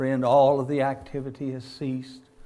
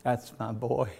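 proud father; 3 semitones lower, 5 dB quieter than usual. Retold or imitated. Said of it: "and he'd say".

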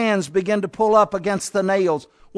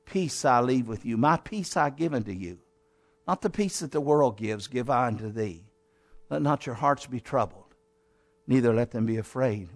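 softer, lower, hushed words of comfort; tender, soft Jesus; 8 semitones lower, 8 dB quieter than usual. A narrator's reading. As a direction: speak peace gently to frightened friends. Jesus's farewell words of peace.

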